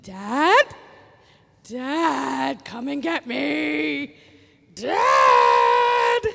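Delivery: high, loud calls with a wailing final "Dad!"; a little boy crying out from his crib; toddler calling for dad. Retold or imitated. Toddler wails for his dad in the night, a loud, crying call. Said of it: "I heard him go".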